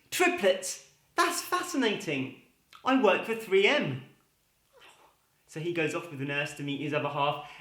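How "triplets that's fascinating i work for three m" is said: higher pitched, amazed then deadpan; surprised father. Acted. Second father reacts to triplets, high and amazed. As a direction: gasp it out, then drop the punchline.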